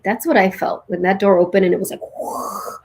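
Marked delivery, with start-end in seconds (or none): 2.2-2.8 breathy rushing whoosh sound; soft whoosh of rushing light; whispered whoosh. Retold or imitated. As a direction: let the light rush in on a breath. Door opens, light pours in.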